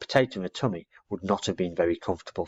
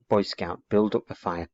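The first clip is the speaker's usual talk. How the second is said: ordinary delivery, nothing marked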